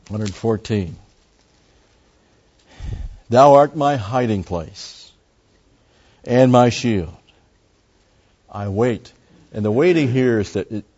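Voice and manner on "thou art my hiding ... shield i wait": slow, loud, high reading of the verse; solemn scripture reading. Acted. Teacher reading Psalm verse to his Bible class, slow solemn reading. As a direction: intone the psalm like a preacher in the pulpit.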